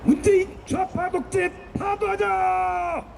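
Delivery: high, slow rally chant shout; shouted slogan. Real, not acted. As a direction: cry it out to the crowd. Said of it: "외칩시다".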